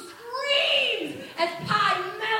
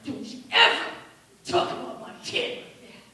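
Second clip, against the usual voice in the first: low menacing threat; 9 semitones lower, faster than usual. Retold or imitated. Acted father threatening.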